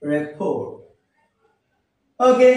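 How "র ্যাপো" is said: রিপোর্ট with silent final t; correct pronunciation. Shown as a demonstration. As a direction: say রিপোর্ট low as "re-pho". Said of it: "আবার খেয়াল করি".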